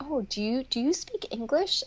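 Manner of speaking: lightly voiced patronizing question; a hint of talking down; mock condescension.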